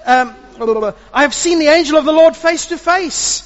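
very high, loud cry of dread; terrified cry. Acted. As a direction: wail out in terror. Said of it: "he cried out".

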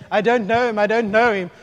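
loud, high-pitched protest; insistent loud denial. Acted. Peter keeps talking to deny Jesus, loud insistent protest. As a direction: insist loudly, pitched well up. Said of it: "all of his talking".

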